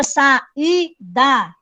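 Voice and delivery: "saída" split slowly into sa, í, da; syllable by syllable. Shown as a demonstration. Teacher showing students the lone I in a syllable, syllable by syllable. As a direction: separate each syllable of "saída", the í standing alone. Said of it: "o I fica sozinho numa sílaba".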